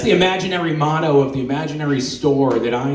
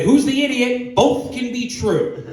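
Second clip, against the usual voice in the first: much higher, slowing mock comeback; high mock retort; 12 semitones higher than usual. Answering his girlfriend, a high smug retort. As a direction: squeak back a smug retort. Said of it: "And I said".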